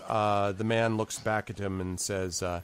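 ordinary delivery

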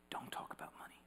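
whispered again, unvoiced; echoing hushed whisper. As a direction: repeat the warning in a hush. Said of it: "was whispered in my ear".

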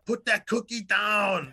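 Arnold impression. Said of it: "And she says".

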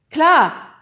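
sudden high, loud yell; excited shout. A narrator's reading. Nephew shouting his answer into the camera, excited. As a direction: blurt it out eagerly.

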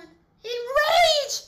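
loud, high-pitched cry; dramatic outburst. Acted. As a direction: burst out in horror. [name] dramatizes listeners' rage.

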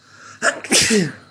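loud sudden real sneeze; a big explosive sneeze; sneezing. Real, not acted. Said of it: "I sneeze".

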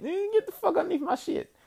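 very high, squeaky fake outrage; hammed-up telling-off; mock indignant. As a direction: shoo them off in a joking high voice. Repeats the joking telling-off, laughing.

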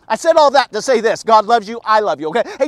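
ordinary delivery

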